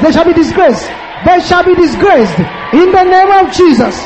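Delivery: full-force prayer against evil powers; preaching at full force. Acted. Loud prayer of declaration.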